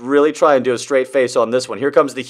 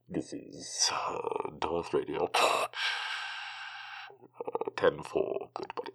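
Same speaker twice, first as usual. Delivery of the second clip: low, breathy Darth voice on the radio; James Earl Jones impression; 9 semitones lower, 21 dB quieter than usual. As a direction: growl softly like Darth Vader on a CB. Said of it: "going like".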